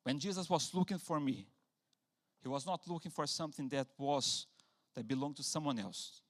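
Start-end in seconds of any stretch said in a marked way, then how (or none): none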